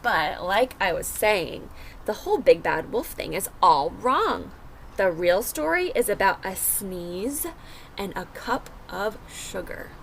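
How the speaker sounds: put-on lively first-person wolf narrator for kids; storybook read-aloud. A narrator's reading. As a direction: tell it as the wolf, reading to a class. Reads the wolf's story aloud.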